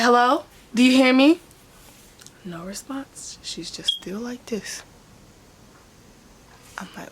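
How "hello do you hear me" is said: calling out. Retold or imitated. Calling to unresponsive grandma on call.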